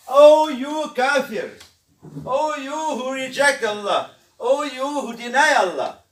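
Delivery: loud, slow solemn address; declaiming. Shown as a demonstration. Preacher proclaiming the surah's address to the rejecters, slow and loud. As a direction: call out each line slowly.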